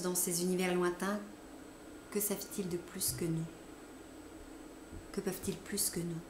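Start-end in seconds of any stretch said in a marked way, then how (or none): 2.1-6.2 awed whisper. A narrator's reading. Awed musing on other worlds.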